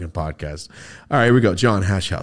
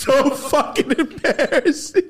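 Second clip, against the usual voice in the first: high, drawn-out words through laughter; real laughing mockery; 3 dB louder, much slower than usual. Real, not acted. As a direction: crack up while rubbing in the embarrassment. Laughing at friend's embarrassment.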